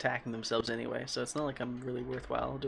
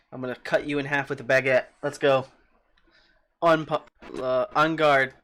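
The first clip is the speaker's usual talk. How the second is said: mock challenge; 8 dB louder than usual. Playful mock sword challenge.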